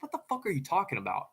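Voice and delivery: baffled protest. Retold or imitated. Girlfriend answers his creature theory, high baffled protest. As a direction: imitate an exasperated girlfriend, pitch jumping up.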